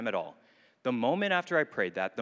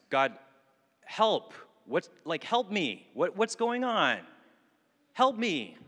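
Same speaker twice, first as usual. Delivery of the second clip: high, pleading cry of help to God; desperate prayer; 6 semitones higher, slower than usual. Retold or imitated. Teen crying out to God in the rain, high and desperate. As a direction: plead upward, voice high and raw. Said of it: "That's what I prayed".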